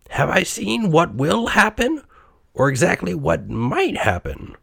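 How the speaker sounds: high, questioning character voice; Scrooge. A narrator's reading. Old miser questions the silent ghost, high anxious voice. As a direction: ask anxiously, high then easing down. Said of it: "asked Scrooge".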